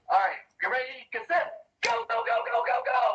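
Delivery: high, rising race call; excited goblin starter. Acted. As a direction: start the race, pitch climbing to a squeal. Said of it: "the goblin goes".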